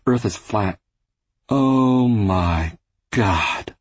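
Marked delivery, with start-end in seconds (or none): 1.5-3.8 slow, stretched-out sarcastic drawl. Acted. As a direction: drag each word out mockingly. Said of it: "She stretched the words out sarcastically".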